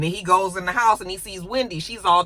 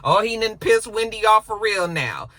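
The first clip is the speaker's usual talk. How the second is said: normal talking voice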